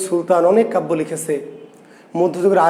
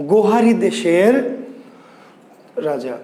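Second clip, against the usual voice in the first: ordinary delivery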